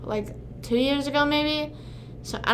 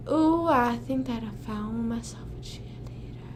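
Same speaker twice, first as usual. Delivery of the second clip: whispered, softer sung line; whispered lyric; 6 dB quieter than usual. Acted. Streamer whispering the chorus to chat.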